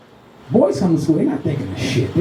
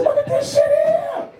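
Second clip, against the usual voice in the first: very high, slow squeal; mock disbelief; slower than usual. Comic reacting to the scooter kid, very high and slow.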